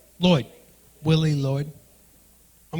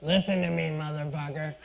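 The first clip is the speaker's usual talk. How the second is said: mom voice; 3 semitones lower, 5 dB quieter than usual. Retold or imitated. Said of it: "She said".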